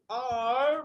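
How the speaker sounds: আর stretched very slowly and fondly; coy drawn-out ar. Shown as a demonstration. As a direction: drag out আর with a coy lilt. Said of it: "মানে একটু ঢং".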